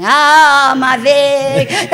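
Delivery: hammed-up, high, very loud wail; mock agonised scream. Imagining herself burning with the Vic, hammed-up scream. Acting herself going up in flames.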